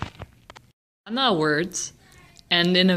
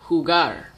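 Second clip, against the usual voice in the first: jugar with the weight on GAR; stress on last syllable. Shown as a demonstration. Teacher repeating a word for students to classify, last syllable of jugar stressed. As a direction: lean on the final syllable of jugar. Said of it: "the emphasis is on the last syllable".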